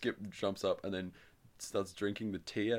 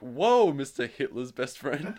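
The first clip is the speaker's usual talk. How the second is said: loud, high "whoa", then drawn-out jeer; mock astonished call-out; 4 semitones higher, 8 dB louder, slower than usual. Calling out someone's blunder, mock astonishment. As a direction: make a big show of catching someone out.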